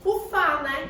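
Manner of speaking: ordinary talk, nothing marked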